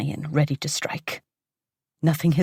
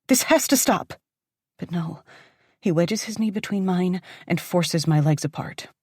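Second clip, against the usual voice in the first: ordinary delivery, nothing marked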